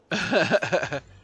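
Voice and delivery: short, high burst of laughter; real laugh, loud and high. Real, not acted. Player laughs after declaring it personal, loud laughter. Amused at own threat.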